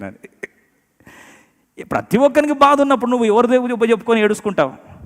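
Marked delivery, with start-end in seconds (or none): 1.8-4.9 high, loud preacher's challenge; forceful preaching. Real, not acted. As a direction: put it loudly and high to the whole congregation.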